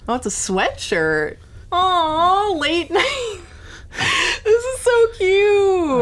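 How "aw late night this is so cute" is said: delighted squeal. Real, not acted. Opens the sweatshirt gift.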